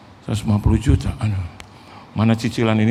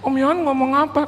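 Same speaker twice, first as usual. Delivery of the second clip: high, put-on questioning voice; imitated tearful question; 12 semitones higher than usual. Pastor asks wife what visitor said, imitated tearful question. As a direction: ask it high, as if choked up.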